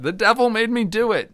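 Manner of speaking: hammed-up devil-made-me excuse; mock whiny excuse. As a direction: squeak it like a caught kid. Mocking a typical excuse.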